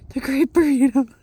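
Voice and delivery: loud, grand announcer voice. Pretend. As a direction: present it like a trophy. Presenting her burrito grandly.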